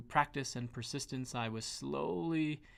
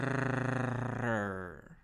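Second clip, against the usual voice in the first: embarrassed laugh. Real, not acted. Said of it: "it's sort of a silly exercise".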